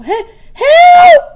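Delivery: high, loud, breaking cry of help; a panicked, half-sobbing yell; terrified cry. Acted. Boy stuck by the bear cries out in panic. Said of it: "Louis tried to call".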